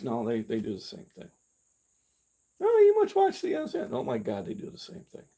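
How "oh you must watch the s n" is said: mocking voice. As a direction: parody a know-it-all pushing a channel. Mocking people's news advice.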